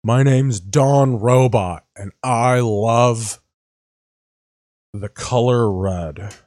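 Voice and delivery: slow, robotic put-on anchor voice; slowly playing a wooden TV anchor; mock Fox News woman. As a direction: spell it out like a stiff newsreader. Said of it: "all the girls are the ones who talk like this".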